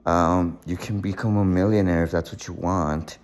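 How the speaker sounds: usual speaking voice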